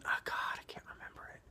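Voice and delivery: whispered aside to self; whispering. Real, not acted. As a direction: drop to a whisper, frustrated. Forgets name, mutters aside.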